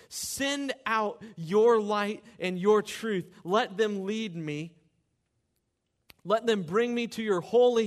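rising to loud pleading on the cries; impassioned scripture reading. Acted. Pastor reading the psalm to the congregation, fervent. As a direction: read the psalm like a prayer cried out. Reads psalms aloud with fervour.

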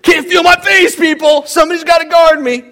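high, loud boastful yell; cocky shout. Retold or imitated. Preacher voicing a player bragging to the crowd, loud cocky shout. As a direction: shout it like an athlete showing off. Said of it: "he goes".

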